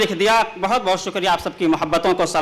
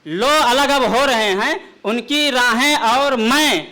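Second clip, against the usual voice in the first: measured, declaiming poetic delivery; ghazal recitation; 3 semitones higher, slower than usual. Acted. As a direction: recite the couplet grandly.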